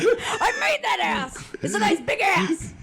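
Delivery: mock proud dad. Mimicking her dad.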